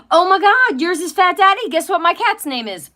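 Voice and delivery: high and loud, bursting with delight; can't believe the coincidence; excited squeal; thrilled. Real, not acted. Thrilled by a matching cat name.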